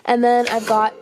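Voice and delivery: ordinary delivery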